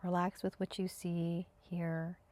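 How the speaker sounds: calm, even meditation guidance with long pauses; spacious, unhurried meditation voice; guided meditation. Acted. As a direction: guide them into stillness, leaving space after each phrase. Leads breathing and senses meditation.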